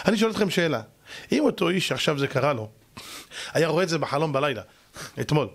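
ordinary talk

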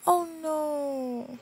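high, drawn-out mock "oh no"; mock dismay. Voicing the Sims as results are announced, mock dismay. Voices safe Sims' reaction.

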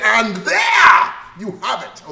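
"And there you have it" belted slowly and loudly, stretched out, high; said with passion. Shown as a demonstration. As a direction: hit "And there you have it" like you feel it in your soul, slow and loud. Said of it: "Say it with passion".

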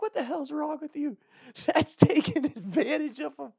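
imitated screaming girlfriend. Girlfriend telling off the woman taking her drunk friend, mock scream. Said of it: "I remember my girlfriend screaming at her".

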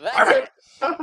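very high, squeaky verdict; mock delighted approval. Casting people approving the crazy take, mock high delight.

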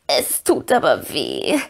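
slow, low, drawn-out croak; croaking Gollum. A narrator's reading. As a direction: croak it out in pain. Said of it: "krächzte Gollum".

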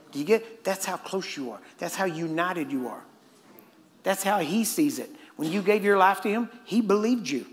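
ordinary talk, nothing marked

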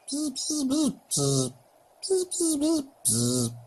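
high rattling buzz sounds; playful insect buzz, jumping up high; buzzing insect imitation. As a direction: mimic a rattling insect in the grass. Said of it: "There's a rattling testicular in the background".

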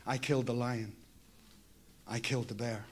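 ordinary talk

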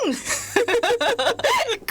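high falling giggle; giggling at being caught. Real, not acted. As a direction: break into embarrassed giggles. Laughing after being teased.

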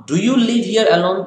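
"Do you live here alone" lifted high, rising at the end; with emphasis. Acted. Teacher showing how the inflection has come, emphatic rising question. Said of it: "he's saying with emphasis".

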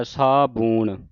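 ਸਾਬੂਣ drawn out slowly with a long u; ਸਾਬੂਣ slow, long u, as written; careful pronunciation. Shown as a demonstration. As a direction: stretch ਸਾਬੂਣ to model the long u. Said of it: "ਸਾਬੂਣ ਹੀ ਕਹਿਣਾ ਉਹਨੂੰ".

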